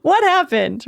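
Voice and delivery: high, rushed panicky questions; panicked. Retold or imitated. Patient panicking at the dentist's silence. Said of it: "go into some version of a panic attack".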